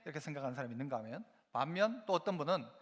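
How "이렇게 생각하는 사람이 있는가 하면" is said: normal talking voice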